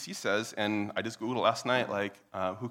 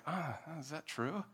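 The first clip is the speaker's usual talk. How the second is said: soft, slow, higher musing; doubtful wondering; 6 semitones higher, 10 dB quieter, slower than usual. Pretend. Listener wondering at the argument, softly doubtful. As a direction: hesitate softly as if half persuaded. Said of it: "that sounds like".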